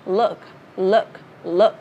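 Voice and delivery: "look" with a lax "ừ" vowel, punched loud; firm, brief "look" model; short U vowel. Shown as a demonstration. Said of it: "Nó sẽ là ừ".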